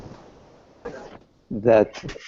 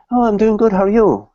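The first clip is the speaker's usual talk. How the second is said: breezy reply; 8 semitones higher, faster than usual. Acted. Man answers friend, untroubled.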